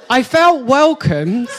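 slow words through laughter; preacher laughing at his own story. Real, not acted. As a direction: try to stay serious while laughing. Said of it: "I'm trying to make a point here".

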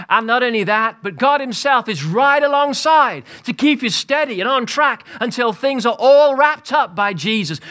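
high, loud, ringing sermon build; preaching at full force. Acted. Pastor rousing his congregation, high and loud preaching. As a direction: preach it out to the whole hall, rising to a shout.

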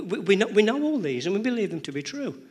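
normal talking voice

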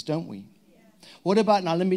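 normal talking voice

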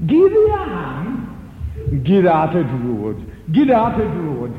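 loud gruff order; loud gruff mockery. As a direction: bellow like a burly Yorkshireman. Big man mocks and shoves.

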